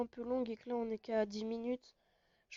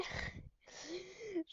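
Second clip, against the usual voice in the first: short high-pitched laugh; 9 semitones higher, 7 dB quieter than usual. Real, not acted. Laugh after dying in game.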